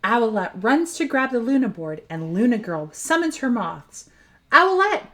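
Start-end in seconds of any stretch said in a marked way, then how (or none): 4.5-5.1 high urgent warning call; shouted warning, high and loud. Acted. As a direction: yell it out to warn her. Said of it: "shouts Gecko".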